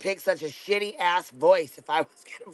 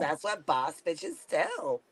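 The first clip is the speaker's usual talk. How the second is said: put-on softer, slow Valley Girl drawl; 7 dB quieter, slower than usual. Friend gives a softer, slow Valley Girl take. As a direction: drag the words like a bored rich girl. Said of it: "Valley Girl accent".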